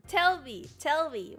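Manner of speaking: ordinary talk, nothing marked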